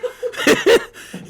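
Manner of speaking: high-pitched burst of real laughter. Real, not acted. Laughing at his friend's corned beef haul, high burst.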